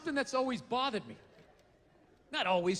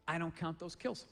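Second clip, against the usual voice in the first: deadpan low punchline; 8 semitones lower, 7 dB quieter than usual. Acted. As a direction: lower and softer, dry delivery.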